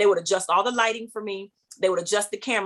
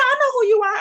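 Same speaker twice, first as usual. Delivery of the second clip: [name]; 7 dB louder, faster than usual. Retold or imitated. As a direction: sing it out high to someone you recognize. Imitates Whitney's reply.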